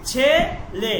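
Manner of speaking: "ছেলে" split into two long syllables; "ছেলে" said as chhe, then le; drawn-out e vowel. Shown as a demonstration. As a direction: stretch both e sounds in "ছেলে". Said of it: "এভাবে বে".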